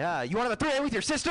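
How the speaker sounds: high, fast blurt; mock frantic bedroom talk; overeager dirty talk. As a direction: get carried away and blurt it. Acting his bad dirty talk escalating.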